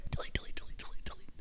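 rapid-fire repeats in a hushed whisper; secretive, voiceless chant. Shown as a demonstration. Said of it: "why you whisper it".

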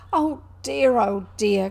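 loud, exasperated lament; loud wail of hopeless frustration; despairing cry. A narrator's reading. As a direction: cry out in despair at someone's obtuseness. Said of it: "cried the Rat in despair".

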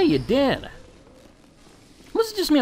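ordinary delivery

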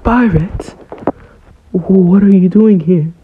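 high, loud panicked question; scared shriek. Retold or imitated. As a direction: squeal it out like a terrified kid who just found a stranger. Scared prisoner Kobe finds stowaway.

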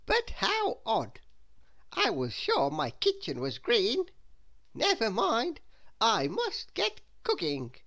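high, swooping character voice; Little Miss Scatterbrain. A narrator's reading. Little Miss Scatterbrain puzzles over her kitchen, in high voice. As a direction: muse aloud in a high, drawn-out voice. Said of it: "said little Miss Scatterbrain".

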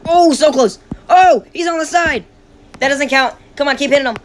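high excited cheering; cheering on a fight. Real, not acted. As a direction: shout encouragement at the fighter.

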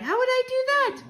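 put-on very high-pitched child's question; astonished cry. A narrator's reading. Mouse grandchild asking how to destroy witches, astonished cry. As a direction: squeak it out, far up in pitch. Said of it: "I cried".

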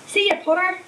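a cheery voice calling across the platform. A narrator's reading. Farewell called across platform.

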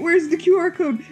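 normal talking voice

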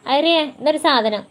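loud, high shout across the house; calling out sharply. Real, not acted. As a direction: yell it to someone off-mic. Calling to someone off stream. (ഉറക്കെ വിളിച്ചു ചോദിക്കുന്നു)

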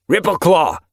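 high, loud sharp call. A narrator's reading. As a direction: call him back across the rocks. Warrior called back sharply.

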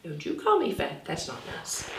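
a playful hushed telling-off; mock scolding. Teacher pretending to scold the class, hushed.

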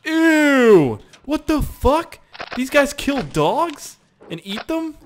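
loud grossed-out cry; disgusted shout. Real, not acted. Gamer finding dog meat, shouting in disgust.

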